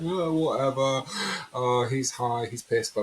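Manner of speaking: put-on "yeah, right" jeering; mock dismissive. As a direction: imitate friends brushing it off. Friends scoffing at his vow.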